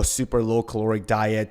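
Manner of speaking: ordinary delivery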